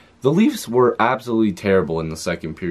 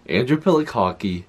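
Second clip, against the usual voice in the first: ordinary talk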